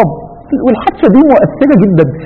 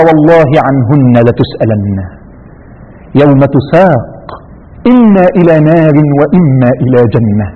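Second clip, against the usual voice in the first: grave warning; 9 semitones lower, slower than usual. Retold or imitated. Poor Bedouin warns Omar in verse.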